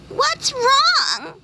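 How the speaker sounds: very high tiny voices; friendly fireflies; fireflies asking. Acted. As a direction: ask kindly, like little fireflies. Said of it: "they asked".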